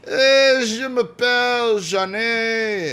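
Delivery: slow, high, drawn-out French; French accent. Shown as a demonstration. Asked for French accent, answers in drawn-out French accent. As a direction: stretch it out in a hammed-up French accent. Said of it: "And now your French accent".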